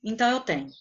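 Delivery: ordinary talk, nothing marked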